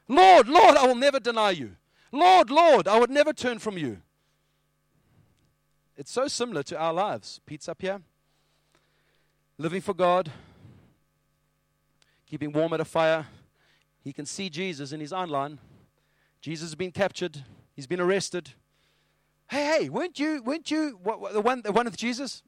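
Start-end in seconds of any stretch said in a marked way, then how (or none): 0.1-4.0 loud, high cry of devotion; fervent vow. Acted. Peter swearing loyalty to his Lord, fervent cry. 19.5-22.4 high-pitched, stammering accuser; nosy bystander. Acted. Bystander spotting Peter by the fire, excited accusation.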